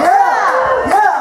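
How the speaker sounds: high excited shout back; crowd-pumping yell. Real, not acted. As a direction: shout it to the room. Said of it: "call and response".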